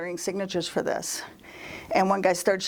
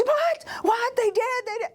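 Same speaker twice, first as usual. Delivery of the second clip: very high, startled squeal of questions; frantic high-pitched disbelief; mock alarm. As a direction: imitate someone shocked, voice leaping way up. Canvasser alarmed by teased news.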